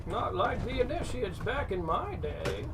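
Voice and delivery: put-on high, slow creaky old man. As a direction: imitate a grumpy old veteran. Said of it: "Need to do the old man voice more often".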